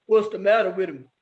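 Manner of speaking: low put-on boy voice; deep boyish question; boys asking. A narrator's reading. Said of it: "the boys wanted to know".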